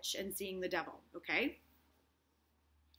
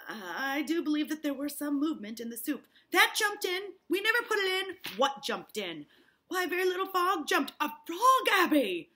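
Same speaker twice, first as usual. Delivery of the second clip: voiced characters; 7 semitones higher, 8 dB louder than usual. Acted Parris and Abigail exchange.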